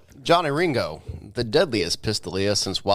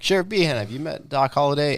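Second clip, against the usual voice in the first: higher, mock-polite introduction; Wyatt Earp; 6 semitones higher than usual. Wyatt presenting Doc to Sheriff Behan, raised pointed voice. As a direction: lift the voice as if presenting someone. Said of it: "when Wyatt's like".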